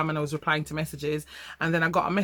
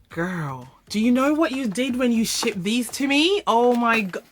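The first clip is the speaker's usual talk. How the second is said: higher, louder, swept up in thanks; overwhelmed gratitude; 5 semitones higher, 3 dB louder than usual. Real, not acted. Vlogger thanking subscriber Ashley for the gift, overwhelmed. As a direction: gush at her with disbelief.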